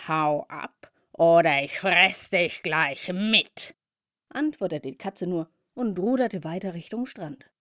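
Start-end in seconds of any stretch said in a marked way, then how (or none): 0.1-3.8 menacing cat. Acted. Cat threatens the bumblebee.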